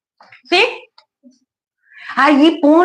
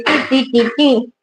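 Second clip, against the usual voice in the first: imitating dance beat; slower than usual. Shown as a demonstration. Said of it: "Se hace así".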